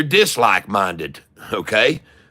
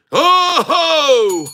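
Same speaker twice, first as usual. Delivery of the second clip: a high, loud triumphant "Oh-ho!"; gleeful whoop; 6 dB louder than usual. Real, not acted. Streamer cheering his stock pick, high and loud. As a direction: crow with delight.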